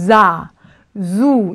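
자 and 주 begun with a buzzed Z; voiced S. Shown as a demonstration. Teacher shows S before vowels, buzzed Z. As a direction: draw out 자, 주 on a voiced Z. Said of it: "S가 모음 앞에 붙을 경우는".

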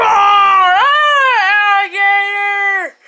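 high, loud panicked cry; scared scream. Acted. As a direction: shriek at the sight of the beast. Scared by crocodile.

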